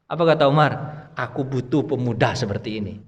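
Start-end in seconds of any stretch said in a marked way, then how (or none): 1.2-2.9 calm approval. Retold or imitated. Umar's calm reply quoted.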